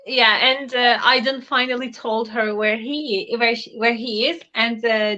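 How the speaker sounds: normal talking voice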